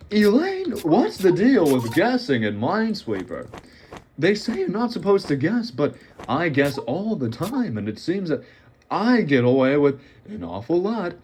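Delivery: wry observational-comic bit aimed at Elaine; sitcom comedian. Acted. Sitcom-style address to Elaine.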